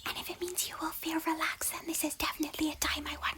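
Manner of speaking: theatrical sneaky whisper; exaggerated whisper. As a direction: overdo the hush playfully. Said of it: "lowers her voice to a slightly exaggerated whisper".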